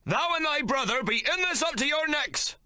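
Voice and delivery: high, threatening shout; mate threatening. A narrator's reading. Mate accusing the brothers, high angry shout. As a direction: pin them with the threat.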